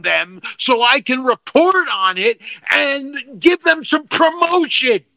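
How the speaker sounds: high-pitched, worked-up venting; angry rant. Real, not acted. Podcast host complaining about the Yuga Labs site, angry rant. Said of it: "we're really not this angry".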